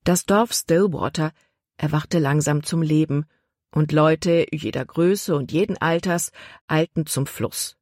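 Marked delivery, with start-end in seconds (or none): none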